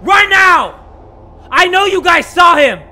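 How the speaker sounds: high, loud terrified shrieking; panicked scream. Real, not acted. Jump-scared gamer screaming at the killer. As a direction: scream it in real fright.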